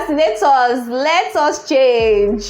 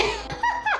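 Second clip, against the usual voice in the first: high laugh; 10 dB quieter than usual. Real, not acted. Laughing after tweet reply.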